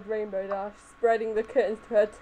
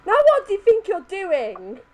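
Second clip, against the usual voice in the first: higher, scolding question; a hint of a prim lady scolding; indignant demand; 8 semitones higher, 4 dB louder than usual. A narrator's reading. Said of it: "she yelled".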